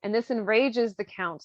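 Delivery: normal talking voice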